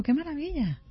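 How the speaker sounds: ordinary delivery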